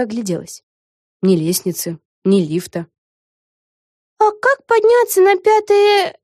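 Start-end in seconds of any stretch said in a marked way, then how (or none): 4.2-6.2 high, slow, questioning; puzzled young girl. A narrator's reading. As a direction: ask a little lost. Вега asks. (растерянный вопрос)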